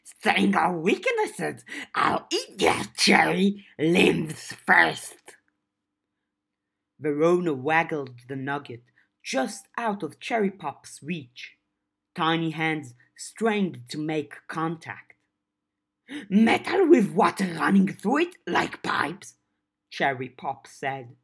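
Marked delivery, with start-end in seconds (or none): 0.0-5.4 slow snarling goblin threat; menacing goblin. Acted. Gashwad threatening. 16.3-19.4 goblin voice. Acted. Goblin Cherry voiced in story.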